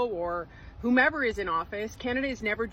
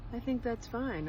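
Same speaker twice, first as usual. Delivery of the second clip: half-hearted Trump voice, then give up; Donald Trump impression; 6 dB quieter than usual. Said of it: "I can't do a Donald Trump impersonation".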